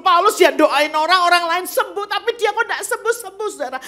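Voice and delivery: high, loud pulpit proclamation; full-force preaching. Acted. Preacher telling the church of Paul's grace, forcefully. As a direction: drive it home to the congregation.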